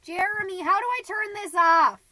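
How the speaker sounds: high, loud yell to someone off-mic; calling out. Acted. Mom calling Jeremy to turn off recorder, loud yell. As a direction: holler for help across the house.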